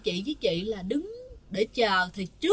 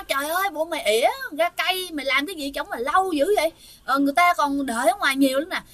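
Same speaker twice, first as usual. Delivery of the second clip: quick, exasperated retold telling-off; grandmother's scolding; 3 semitones higher, faster than usual. Grandmother outside the toilet scolding the woman inside, impatient and hurried. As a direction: rattle off an imitated complaint at raised pitch. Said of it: "đứng chửi, chửi nó".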